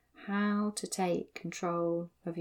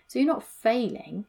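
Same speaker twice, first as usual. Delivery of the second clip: usual speaking voice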